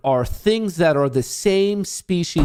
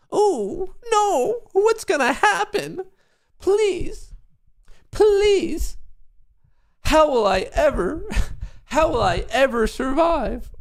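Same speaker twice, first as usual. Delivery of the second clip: hammed-up fear in a much higher voice, with laughs; mock terror; slower than usual. Mocking the speech police, hammed-up falsetto fear. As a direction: squeal like you're terrified, but mocking.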